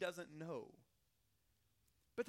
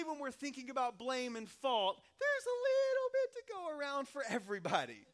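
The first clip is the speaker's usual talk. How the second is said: ordinary talk, nothing marked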